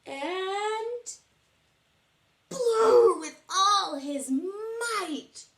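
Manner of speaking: high, loud and slow, straining; mighty blow. Acted. Teacher acting Bear blowing the trumpet, loud and slow. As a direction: stretch it out like blowing hard.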